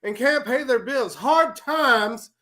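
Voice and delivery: high, loud wrestling promo rant; Dusty Rhodes promo. Acted. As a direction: preach it like a wrestler cutting a promo. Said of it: "I'm gonna go ahead and, uh, appease the masses".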